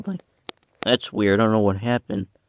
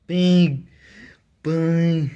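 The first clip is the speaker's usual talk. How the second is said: higher, louder punched Big Bang; big bang call; 5 semitones higher, 3 dB louder than usual. Shown as a demonstration. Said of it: "This is how I say it".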